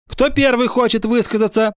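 high-voiced stern class leader; stern question. A narrator's reading. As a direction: ask severely, in a high voice. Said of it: "сурово спросила Ира Сыркина". (сурово, высоким голосом)

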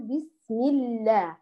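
بسم الله drawn out very slowly, the lam of الله thin and light; light lam in Allah. Shown as a demonstration. Teacher modelling the basmala for her students, very slow. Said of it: "فنقول".

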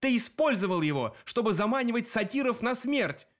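high, indignant narrated yell; yelled accusation. A narrator's reading. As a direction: cry out the accusation without much volume. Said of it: "завопил я".

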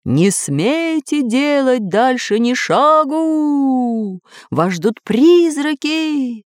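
eerie drawn-out ghostly wail; haunting ghost. A narrator's reading. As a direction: frighten them with long howled vowels. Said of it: "продолжая запугивать Карандаша и его друзей".

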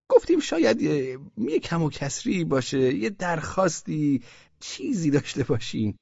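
fishing for a complaint; coaxing official. Acted. Osman Bey coaxing.

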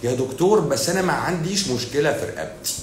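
lightly voiced patient claiming nothing's wrong; mock patient. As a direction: play the stubborn patient insisting. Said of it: "تيجي تقول لك ايه".

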